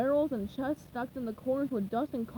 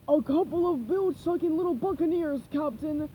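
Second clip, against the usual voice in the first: rasped, higher pirate voice; raspy pirate; 4 semitones higher, 5 dB louder than usual. Acted. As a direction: rasp it like a scrappy deckhand. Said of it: "a higher voice rasped".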